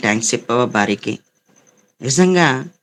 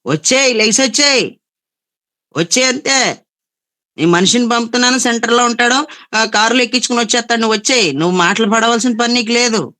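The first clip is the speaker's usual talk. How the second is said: much higher, louder mock mother calling her daughter back; shrill-free but high, pushing insistence: come home now; mother urging daughter home; 9 semitones higher, 3 dB louder than usual. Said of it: "అని".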